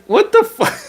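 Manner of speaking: what the fuck. Retold or imitated. Vlogger reacting to fans' comments, high disbelieving squeal. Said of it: "I'm just like".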